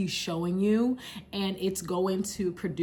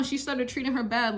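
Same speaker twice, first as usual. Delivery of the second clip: normal talking voice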